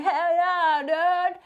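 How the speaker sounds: high, loud mock bro cheer; mock hype. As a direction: cheer like a pumped-up dude. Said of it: "You're like".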